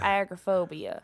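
"agoraphobia" as AG-ruh-PHOBIA; "agoraphobia" with a leading stressed AG; Southern mispronunciation. Retold or imitated. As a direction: imitate the professor's AG-ruh take on "agoraphobia". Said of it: "that's just Southern mispronunciation".

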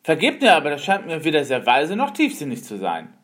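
loud, fast indignant protest. Acted. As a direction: push back loudly and fast. Disappointed man protests to master.

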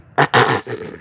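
deliberate throat clearing; mock throat clear. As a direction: clear your throat like a snooty machine. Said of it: "clear her throat".

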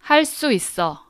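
할 수 있어 said clearly and high, as a model to repeat; clear model pronunciation. Shown as a demonstration. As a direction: say 할 수 있어 crisply for learners. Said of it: "I'm gonna repeat it three times".

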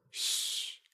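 soft, drawn-out "shh"; hushing shush. Acted. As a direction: shush someone very quietly. Hushing sister before sneaking out. (轻声嘘一下)

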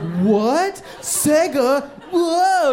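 overacted gasp climbing very high; hammed-up surprise. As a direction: fake delight like a bad actor. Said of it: "Like a bad actor".

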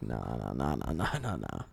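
mock a bored coworker brushing it off; low dismissive nah. Coworker shrugs off the complaint in a low drone, mocking. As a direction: drop the voice way down, droning nah nah.

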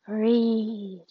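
drawn-out, eerie creature command; long, otherworldly urging; dark thing's voice. Acted. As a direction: stretch the word out like a looming dark presence. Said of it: "the dark thing said".

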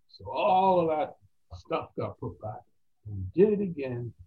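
usual speaking voice

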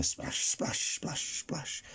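soft breathy splashing sound; whispered splash effect. Acted. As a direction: whisper the splashes like footsteps on water. Sound effect of running on water.

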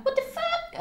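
very high mock squeak; shocked reaction. Acts out shocked reaction to hug.

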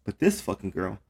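ordinary talk

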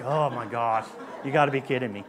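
low, groaning disbelief; mock dismay. Congregation reacting to a dad joke, low mock dismay. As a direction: imitate a listener groaning at a joke.

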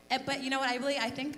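ordinary talk

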